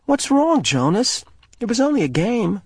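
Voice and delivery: put-on high, concerned girl's voice; Fiona. A narrator's reading. Fiona asking Jonas what is wrong. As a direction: ask gently, puzzled.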